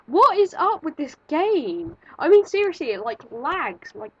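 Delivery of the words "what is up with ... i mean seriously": loud, high-pitched exasperation; exasperated outburst. Real, not acted. Player fed up with a lagging game, exasperated outburst. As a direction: complain loudly at the glitchy game.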